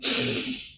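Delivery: long defeated sigh; frustrated fan sighing over trade; heavy sigh. Real, not acted. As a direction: let out a weary unvoiced sigh.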